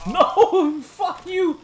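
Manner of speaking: high, loud raging yell; real scream. Real, not acted. Player screaming at the enemy that killed him. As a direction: blow up at the enemy.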